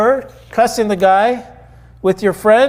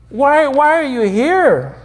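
higher, slowed, exasperated question; frustrated; 6 semitones higher than usual. Acted. Angel supervisor questioning the thief, frustrated. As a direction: plead for an explanation, at wits' end. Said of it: "Out of frustration".

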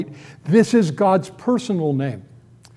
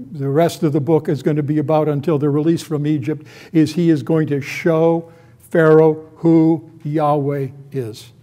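ordinary talk, nothing marked

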